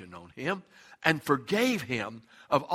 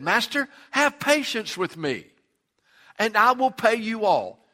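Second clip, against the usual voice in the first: higher, louder desperate plea; pleading servant; 7 semitones higher, 5 dB louder than usual. Acted. Servant begging his master for patience, loud desperate plea. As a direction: beg the master for time.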